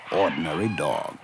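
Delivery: ordinary talk, nothing marked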